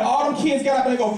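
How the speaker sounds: usual speaking voice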